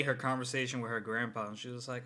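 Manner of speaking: ordinary talk, nothing marked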